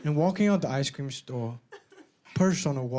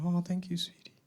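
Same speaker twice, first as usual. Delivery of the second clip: softer, touched thank-you; soft grateful reply; 3 semitones higher, 7 dB quieter than usual. Retold or imitated. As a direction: murmur it sweetly to him. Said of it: "she just goes".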